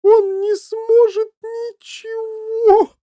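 slow, very high, loud wail. A narrator's reading. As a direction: howl it out in drawn-out despair. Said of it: "голосила миссис Ти Ви".

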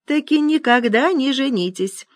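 put-on high-pitched old man's counsel. A narrator's reading. Old man advises caller over the phone, high voice. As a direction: declare it high, as life wisdom. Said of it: "донеслось из трубки".

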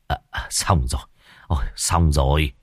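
low, soft relieved exhale; letting the fear drain out; relieved sigh. Acted. Exorcist to old Khiêm after the nailing, relieved sigh. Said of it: "ông thầy thở phào".

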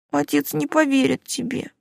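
put-on high, tearful girl's voice, pleading; a crying mermaid girl apologising; tearful young girl. Уля speaks. (плачущая девушка, высокий голос)

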